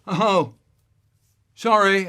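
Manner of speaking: high, loud, flustered "sorry"; a caught child blurting it out; loud apology. Retold or imitated. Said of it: "I said".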